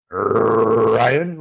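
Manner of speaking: Ryan with a long trilled opening R; rolled R. Pretend. Host greets his man Ryan, playful long rolled R on Ryan. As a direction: draw out a rolling R at the start of Ryan.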